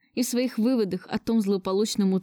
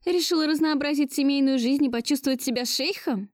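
higher-pitched, mildly sarcastic question; taunting accusation; 6 semitones higher than usual. A narrator's reading. Woman carrying on accusing her lover, taunting. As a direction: needle him in a raised pitch.

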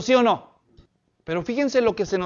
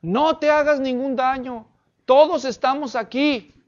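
raised, higher, urgent call; acted shout; 5 semitones higher, 5 dB louder than usual. Paul calling to the jailer not to harm himself, acted shout. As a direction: call out loudly to stop him. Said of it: "le gritó".

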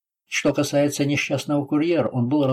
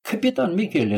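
high-pitched acclaim at moderate volume; crowd shouting; 8 semitones higher than usual. A narrator's reading. Mob recognizing their captain, lightly acted shout. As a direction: cry out a hero's name in narration. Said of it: "закричали Лацарони".

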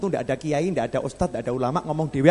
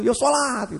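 high-pitched, held-back mock yell; toned-down replay of a shout; imitated patient's yell; 7 semitones higher, slower than usual. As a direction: echo the shout high, without the volume. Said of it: "dokter ini nerangkan ya seperti itu".

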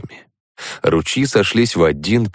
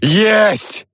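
very high yelled cheer; barked shout; 3 dB louder than usual. A narrator's reading. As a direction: yell the win once more. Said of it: "гаркнул я".

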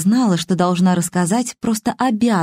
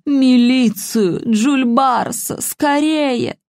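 put-on slow, strained old woman's plea; very old faint voice; 5 semitones higher, much slower than usual. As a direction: drag each word out weakly.

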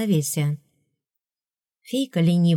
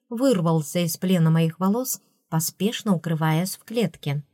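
usual speaking voice

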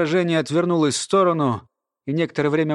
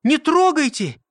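high, urgent plea; narrator's contained high cry; 11 semitones higher, 3 dB louder than usual. A narrator's reading. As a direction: shout a warning, pitched well up. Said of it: "крикнула Ирма".

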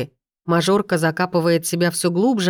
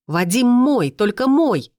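hysterical yell; 6 semitones higher, slower than usual. A narrator's reading. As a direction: claim him at full volume. Jealous scene, yelling. (истеричный крик ревности)

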